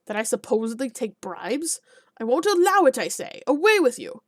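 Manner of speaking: furious denial of bribes; shouting the peasant out; outraged yelling. Acted. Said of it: "yelled the alderman".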